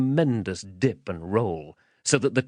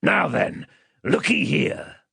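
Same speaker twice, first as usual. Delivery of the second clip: higher, threatening demand; menacing man; 6 semitones higher, slower than usual. Acted. Man demanding the child's name, menacing. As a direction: play a rough interrogator bullying a child.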